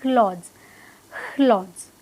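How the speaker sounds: क्लॉथ्स with the K aspirated into kh; falling क्लॉथ्स, puffed K and dz ending; British K-KH mixture. Shown as a demonstration. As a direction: start क्लॉथ्स with a rush of air, "khlodz". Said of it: "ख का जो mixture वाला version है".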